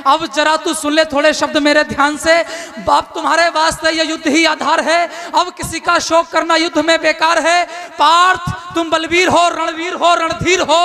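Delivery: stirring declaimed verse; rousing poem recitation. Acted. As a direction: rouse the warrior.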